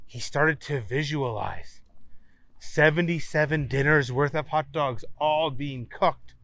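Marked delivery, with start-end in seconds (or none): none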